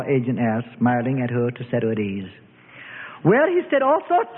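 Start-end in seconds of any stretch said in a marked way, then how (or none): 3.2-4.4 snapped. A narrator's reading. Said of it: "she snapped".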